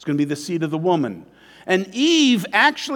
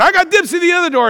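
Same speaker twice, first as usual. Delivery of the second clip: put-on high, loud, fast childish claim; kid claiming dibs; 12 semitones higher, 7 dB louder, much faster than usual. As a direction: yell it like a kid grabbing a seat. Kids fighting over car seats.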